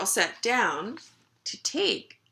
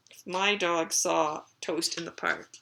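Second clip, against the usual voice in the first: plain reading voice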